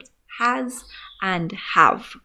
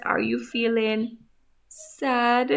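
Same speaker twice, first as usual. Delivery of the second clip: ordinary talk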